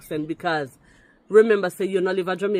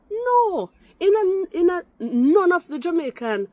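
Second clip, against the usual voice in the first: loud, high-pitched emphatic rebuttal; heated denial; 13 semitones higher, 5 dB louder than usual. Real, not acted. Commenter rejecting claims about Irish roots of patois, in heated denial. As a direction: refute it hotly, voice jumping high.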